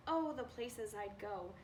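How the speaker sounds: usual speaking voice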